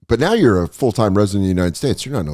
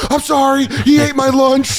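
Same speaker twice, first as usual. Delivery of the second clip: high whiny office goon; whiny excuse; 14 semitones higher, 3 dB louder than usual. Pretend. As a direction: plead your excuse in a high whine. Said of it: "He's like".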